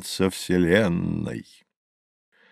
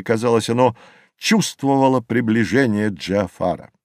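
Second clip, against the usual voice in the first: ordinary delivery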